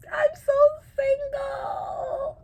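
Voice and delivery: high, hammed-up crying wail; mock wail. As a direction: wail it like a heartbroken drama queen. Self-pitying joke about being single.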